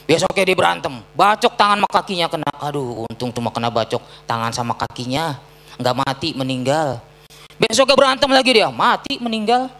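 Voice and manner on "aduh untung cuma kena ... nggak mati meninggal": lightly voiced gossiping neighbour, low and soft; resigned bystander. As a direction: sigh it like a shrugging neighbour. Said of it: "Apa kata orang-orang?".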